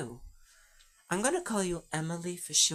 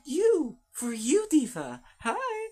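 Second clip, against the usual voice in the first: excited squeal; 11 semitones higher, 6 dB louder, slower than usual. Real, not acted. Gushing over new diva friend.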